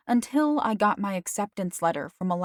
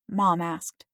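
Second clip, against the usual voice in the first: usual speaking voice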